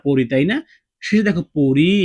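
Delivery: ordinary delivery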